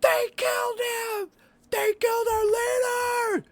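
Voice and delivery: screamed high and loud in alarm; panicked shout. Acted. Cultist discovering his dead leader, shrieking high and loud. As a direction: raise the alarm at the top of your lungs. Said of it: "you hear him shouting".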